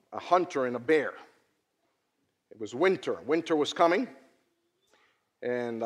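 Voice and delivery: ordinary talk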